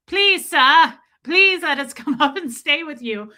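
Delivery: loud, high mock pleading; begging peasant. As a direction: beg like a melodramatic pauper.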